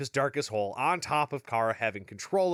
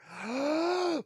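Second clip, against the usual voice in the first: high, dramatic gasp; mock shocked gasp; 13 semitones higher than usual. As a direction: gasp as if scandalized, for show. Mock shock before record scratch.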